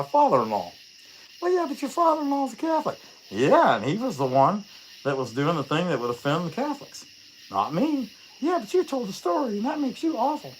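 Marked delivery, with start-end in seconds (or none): 1.4-3.0 high mock-offended viewer voice; squeaky put-on viewer voice; imagined viewer complaint. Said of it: "I can hear it now". 8.4-10.5 high mock-offended viewer voice; squeaky put-on viewer voice; imagined viewer complaint. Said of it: "I can hear it now".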